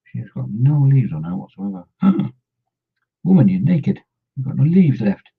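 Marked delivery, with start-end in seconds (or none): 2.0-5.3 gasp, then louder, higher mock outrage; playfully scandalised at the bare tree; mock shock. Scolding a leafless miniature tree, mock gasping shock.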